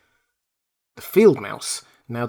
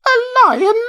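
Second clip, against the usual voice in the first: very high, loud squeak of alarm; alarmed cry; 7 dB louder than usual. Acted. Mouse queen hears of the lion, alarmed squeak. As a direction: gasp in fright. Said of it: "cried the little Queen".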